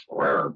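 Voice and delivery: low mock roar; playful lion growl. Spelling out Lyons for viewers, playful growl. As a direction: give a short rolled lion growl.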